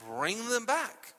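usual speaking voice

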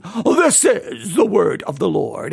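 put-on pious droning; sanctimonious monotone. Preacher mocking lifeless Bible reading, sanctimonious monotone. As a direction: intone it with mock holiness. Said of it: "in a monotone way".

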